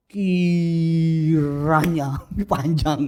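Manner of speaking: very slow, drawn-out grand word; hammed-up ornate prayer language; mock flowery prayer. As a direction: stretch it out pompously. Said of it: "Kakanda, Adinda".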